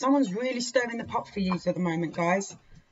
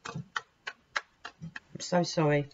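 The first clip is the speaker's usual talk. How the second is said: sorry; 3 dB quieter, much slower than usual. Real, not acted. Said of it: "I was really praying".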